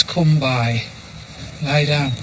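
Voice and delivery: soft, calm, low dog command; quiet command. Shown as a demonstration. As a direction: say it quietly and calmly. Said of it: "the lie down command is quiet".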